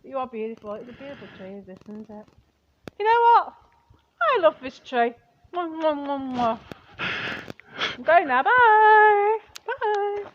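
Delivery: very soft tender cooing; baby-talk a beloved tree. Acted. Wife coos and kisses her tree softly.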